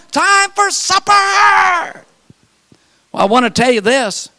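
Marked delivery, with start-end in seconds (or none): 0.1-2.0 high, long-held supper call; drawn-out hollering mom; mother's holler. Retold or imitated. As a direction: sing it out slowly to kids far off. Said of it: "They just hollered".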